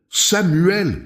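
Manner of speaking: high, urgent call by name; narrated mother's high cry. A narrator's reading. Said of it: "cria-t-elle".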